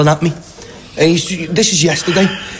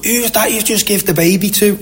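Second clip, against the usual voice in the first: imitated grandmother; 7 semitones higher than usual. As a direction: mimic a grandmother asking in alarm, pitched up. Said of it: "the grandmother or something".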